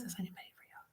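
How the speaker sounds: barely audible, voiceless murmur; very soft, almost to yourself; hushed repeat. Retold or imitated. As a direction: let the question fade to a whisper. Question repeated, fading away.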